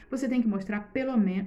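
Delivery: ordinary delivery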